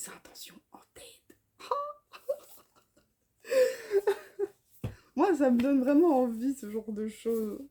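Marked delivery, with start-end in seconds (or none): 0.1-1.4 chuchote la fin comme un secret; chuchoté. A narrator's reading. Résumé lu, chute mystérieuse. 3.5-4.5 petit rire aigu. Real, not acted. Rire après le résumé.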